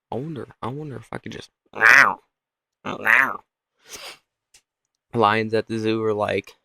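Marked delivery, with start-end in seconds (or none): 0.1-1.5 soft, fast mutter to oneself; quietly plotting under the breath; hushed, quick wondering; musing lion. Pretend. Lion musing about prey. 1.7-3.4 short imitated meow; mock lion meowing; cat noise.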